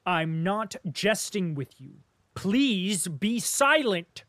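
slow, loud and forceful; slow furious command to be silent; cried out. Acted. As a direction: hammer each word to silence him. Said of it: "cried Bolkonsky".

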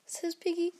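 plain reading voice

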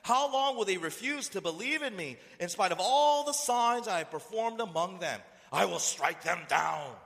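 loud, high booming indignation; wrathful God. Acted. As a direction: thunder it as God's angry judgment. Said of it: "The Lord said to Moses".

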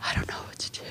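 breathless frightened whisper; panicked whisper. Retold or imitated. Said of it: "but I was like".

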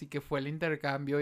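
ordinary talk

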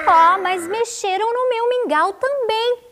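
high-pitched angry complaint; indignant storybook mama bear; cross mama bear. A narrator's reading. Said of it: "A mamãe ursa reclamou zangada".

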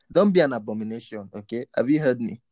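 normal talking voice

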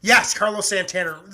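usual speaking voice

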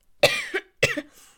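harsh real cough; a sudden loud cough; coughing fit. Real, not acted. Coughing on camera.

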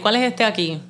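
loud, casual Puerto Rican Spanish; Puerto Rican accent. Shown as a demonstration. Showing co-host the ice cream question, Puerto Rican accent. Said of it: "sound like a Puerto Rican real quick".

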